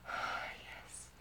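soft unvoiced whisper; hushed, voiceless sigh. Real, not acted. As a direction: let it out on breath alone. Intimate, breath only.

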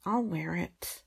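ordinary talk, nothing marked

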